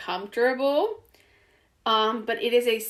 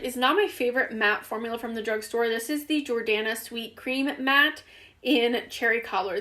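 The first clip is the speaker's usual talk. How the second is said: ordinary talk, nothing marked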